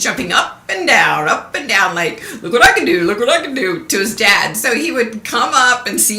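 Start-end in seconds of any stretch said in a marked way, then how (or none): 2.4-3.8 high, loud, laughing little show-off; excited baby monkey. Retold or imitated. Baby monkey showing off to his dad, high and giggly. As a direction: bounce it out like a proud little monkey.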